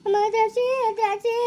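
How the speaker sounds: high wailing cry for help; pained, high-pitched calling; tearful call. Acted. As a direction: call the name through tears. Hurt person cries out for Drakshi.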